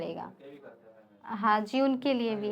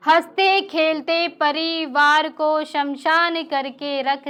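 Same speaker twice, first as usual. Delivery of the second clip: declaimed verse, each line repeated with more force; poem recitation; 5 dB louder than usual. Acted. Poet reciting angry verse to a live audience, impassioned recitation. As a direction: recite the shayari, building on the repeat.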